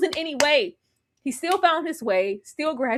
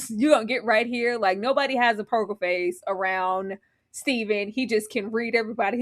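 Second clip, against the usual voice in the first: normal talking voice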